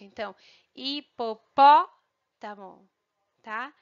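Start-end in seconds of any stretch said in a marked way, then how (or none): 0.7-2.9 "hipopótamo" in slow pieces with PÓ loud and held; hi, po, PÓ, tamo, leaning hard on PÓ; stressed syllable. Shown as a demonstration. Teacher repeating for pupils the tonic syllable, stress on PÓ. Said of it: "fica um pouquinho mais forte".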